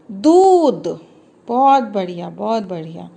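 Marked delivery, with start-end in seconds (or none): 0.1-0.9 put-on दूध said higher and louder, a bright announcement to children; proud reveal. Shown as a demonstration. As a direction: present दूध like a happy reveal. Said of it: "और दू से बन गया एक शब्द".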